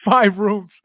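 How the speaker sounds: ordinary talk, nothing marked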